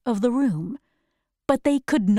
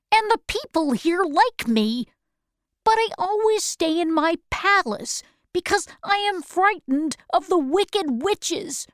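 higher, lightly voiced old man pleading and explaining; wizard's confession; 8 semitones higher than usual. Oz begging Dorothy to keep his secret, light higher voice. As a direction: tell it in a higher little man's voice, hurrying at the end.

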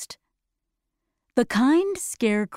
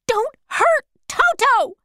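high, loud cry to protect the dog; shouted; 14 semitones higher, 5 dB louder than usual. Acted. Dorothy shouting at the lion to protect Toto, high and loud. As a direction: shout it sharply to stop the lion. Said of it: "she shouted".

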